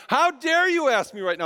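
high-pitched mock outburst; retold indignant snap, pitch jumping up; blowing up. Dad to daughter asking for treats, acted blow-up. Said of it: "I kind of blew up".

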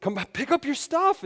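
put-on high exasperated call to the kids; retold yelling. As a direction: call out like a fed-up parent. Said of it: "then I start to yell, like".

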